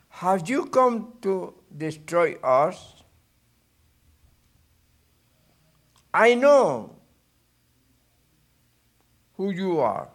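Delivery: the possessed man yells at Jesus; crying out loud. A narrator's reading. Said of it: "he cried out at the top of his voice".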